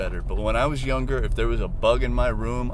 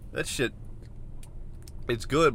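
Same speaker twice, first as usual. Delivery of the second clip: normal talking voice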